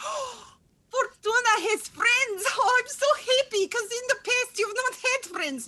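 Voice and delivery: high, loud doting mother voice; high-pitched doting mother cooing; gushing mother. Acted. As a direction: gush at his friends like a proud mother.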